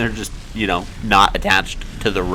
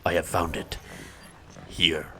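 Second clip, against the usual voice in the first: quiet, lowered Clive impression; softer, lower mock Clive voice; imitating Clive; 4 semitones lower, 10 dB quieter than usual. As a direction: murmur it softly like a solemn hero. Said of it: "Just like Clive talks".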